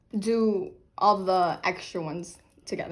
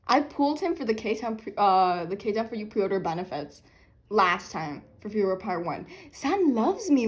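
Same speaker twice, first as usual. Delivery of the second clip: ordinary talk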